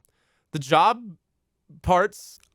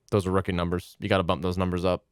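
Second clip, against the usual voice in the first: deep movie quote; 9 semitones lower, faster than usual. Retold or imitated.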